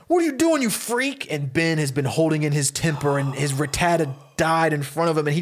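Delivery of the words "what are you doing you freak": high-pitched indignant cry; acted outrage. Teenager whose Pokemon was just captured, outraged. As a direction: protest in a jumped-up, alarmed voice. Teen's Pokemon is stolen, he protests.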